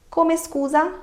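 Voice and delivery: "Come scusa" said high, as a question; questioning intonation. Shown as a demonstration. Teacher shows learners how to ask for clarification, "Come scusa" as high question. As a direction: lift "Come scusa" high, ending in a rise. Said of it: "asking questions with a different intonation".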